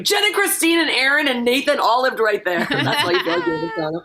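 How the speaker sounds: laughing while telling it; words breaking into laughter. Real, not acted. Laughing through retelling.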